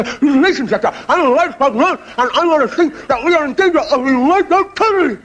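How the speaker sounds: high, wide-mouthed frog voice. Acted. Frog spokesman pleading to Ian Smith, high and wide-mouthed. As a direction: stretch the mouth wide and squeak it out. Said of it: "So he goes to the police inspector".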